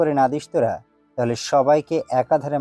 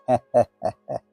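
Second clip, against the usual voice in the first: low mocking laugh; villain's scornful laugh; 12 semitones lower than usual. Gang boss scoffing at the hero's warning, mocking laugh.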